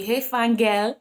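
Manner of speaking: lightly voiced UK chat-up; mock chat-up line. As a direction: ham up a cheeky catcall. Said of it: "in the U K it'll be".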